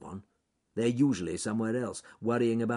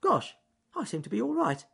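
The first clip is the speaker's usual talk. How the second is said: tailing off in surprise; 13 semitones higher than usual. Acted. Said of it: "his voice tailing off in surprise".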